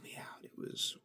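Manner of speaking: hushed whisper, no voice. Acted. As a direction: whisper it like a sleepy secret. Describing being knocked out asleep.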